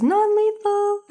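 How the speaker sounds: very high, drawn-out mock squeal; silly high cartoon magic voice; comic poof voice. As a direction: float it way up high and stretch it out. Reading out the cartoon poof's word.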